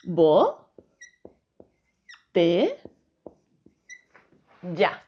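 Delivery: slow spaced syllables for pupils. Shown as a demonstration. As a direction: lay out "botella" beat by beat. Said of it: "La división en sílabas de botella sería".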